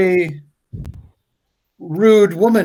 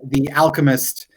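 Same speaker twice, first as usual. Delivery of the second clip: ordinary talk, nothing marked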